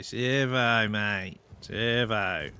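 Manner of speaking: broad nasal Australian "Servo, mate". Shown as a demonstration. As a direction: lay on the Aussie accent, through the nose. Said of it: "you gotta really say it nasally to make it properly Australian".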